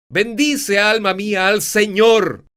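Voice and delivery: loud, high praise call; booming Bendice alma mía. Shown as a demonstration. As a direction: bless the Lord at full voice. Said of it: "A veces fuerte".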